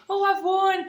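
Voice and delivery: high-pitched excited squeal of triumph, imitated; mock victory cry. Imagined cry of victory to family.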